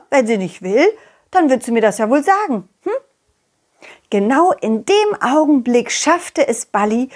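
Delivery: ordinary talk, nothing marked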